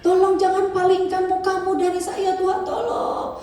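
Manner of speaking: high pleading cry to God; pleading prayer, voice lifted high; desperate retold prayer. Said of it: "saya teriak".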